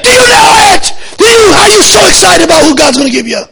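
high, loud shouted preaching; preaching at full force. Real, not acted. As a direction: pour it out like a revival preacher, shouting.

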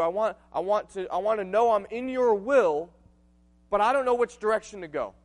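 high, loud desperate prayer cry; pleading prayer. Acted. Preacher voicing a believer begging God for direction. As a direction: plead with God at full force.

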